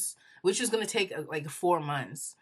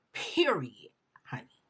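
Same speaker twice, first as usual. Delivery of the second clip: sassy whisper; 3 semitones lower than usual. Retold or imitated. Defiant quote ending.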